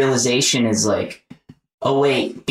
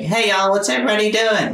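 high, quick Southern greeting; your own voice in pitched-up Southern mode; Southern accent; 10 semitones higher, faster than usual. Shown as a demonstration. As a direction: chirp it in a Southern twang. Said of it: "my Southern accent".

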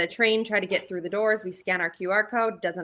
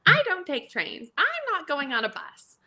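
high-pitched imitation of a friend refusing; mock refusal; 7 semitones higher than usual. Travel friend refusing trains and buses, mock high-pitched protest.

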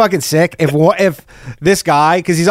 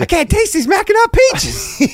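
mock alter voice.

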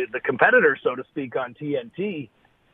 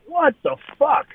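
stunned outburst; 12 semitones higher, 3 dB louder than usual. Retold or imitated. Wrestler hearing he's fired on the phone, stunned shriek. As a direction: pitch leaps way up in shocked disbelief. Reacting to firing news.

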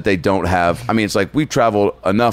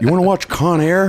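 raised, put-on movie-star voice; exaggerated impression pitched well above usual; Nicolas Cage; 8 semitones higher, 3 dB louder than usual. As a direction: offer it in a high actor imitation. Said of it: "Fucking Nicolas Cage on him".

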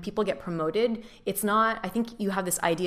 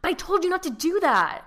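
much higher, exasperated rebuke; exasperated scold; 12 semitones higher, 4 dB louder than usual. Retold or imitated. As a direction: snap at the new hire, exasperated. Retold stressed mentor scolding.

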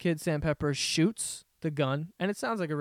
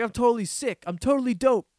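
mock dude voice; 6 semitones higher, 3 dB louder than usual. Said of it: "He's like".

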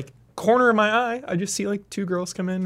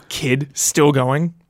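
ordinary delivery

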